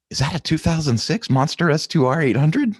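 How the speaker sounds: high, loud excited woman's voice; lady's excited question. Retold or imitated. A dealer's colleague calling from the background, high and excited. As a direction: squeal it out like an eager fan. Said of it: "a lady in the background goes".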